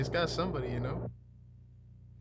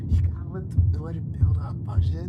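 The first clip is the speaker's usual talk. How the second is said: excited; 7 semitones higher, 12 dB louder than usual. Real, not acted. Hyped commentary over video.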